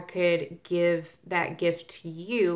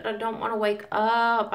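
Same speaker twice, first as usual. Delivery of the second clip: higher whiny morning complaint; mock groggy whine; 4 semitones higher, 4 dB louder than usual. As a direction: whine like you hate getting up. Groggy self voiced on Monday morning.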